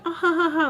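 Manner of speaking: high, loud imitation of a little laugh; mocking fake laugh. Vlogger showing the little laugh she hates, mocking. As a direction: mimic her laugh with annoyance. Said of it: "that, like, little, like".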